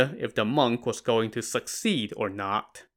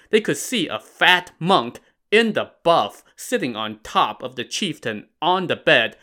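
usual speaking voice

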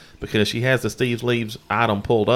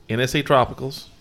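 ordinary talk, nothing marked

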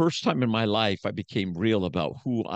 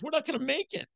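very high, desperate imitation of his wife; mock the wife's despair in a high voice; high desperate wife impression; 3 dB quieter, faster than usual. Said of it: "She goes".

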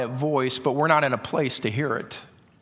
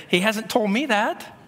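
retold protest; 8 semitones higher than usual. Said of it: "And I would say".

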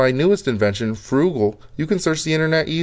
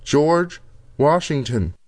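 slow and clear; much slower than usual. Acted. Repeating to voice system.

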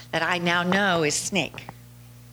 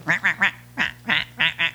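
lightly voiced duck calls, repeated rah; imitating quacking; 3 semitones lower, much faster than usual. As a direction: call to the ducks with a mock quack. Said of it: "and he's quacking".

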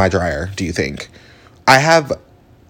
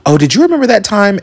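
high, bright mock question; sudden high aside; mock excited aside; 11 semitones higher, 3 dB louder than usual. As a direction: pop up high like a sudden memory. Self-quote while rambling.

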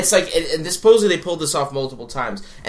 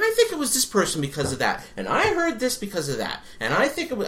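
retold eager theorizing; 9 semitones higher than usual. Mimicking fans trading theories.

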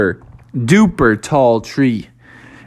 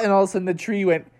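ordinary delivery, nothing marked

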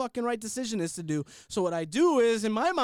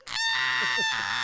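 daughter's scream; 6 semitones lower, 3 dB louder than usual. Retold or imitated. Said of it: "Like, screaming".

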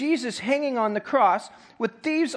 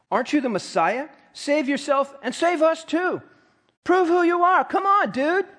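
emphasized taunting criminal; 8 semitones higher than usual. Retold or imitated. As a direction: hurl the insult like the criminal on the cross. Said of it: "That's me emphasizing scripture here".

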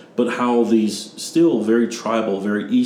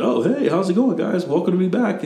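oblivious cheery greeting; 11 semitones higher than usual. Acted. Dad arriving with no clue what happened, oblivious cheery greeting. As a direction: greet everyone brightly, pitched way up.

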